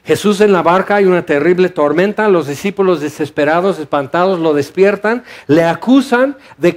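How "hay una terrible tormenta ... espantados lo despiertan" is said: ordinary talk, nothing marked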